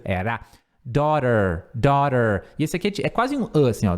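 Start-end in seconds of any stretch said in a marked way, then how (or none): none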